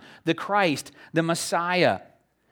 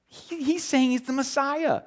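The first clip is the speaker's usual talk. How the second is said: higher, slow dawning realization; mock excited follower; 10 semitones higher than usual. Preacher acting followers who suddenly get it, excited. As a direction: catch on with rising wonder.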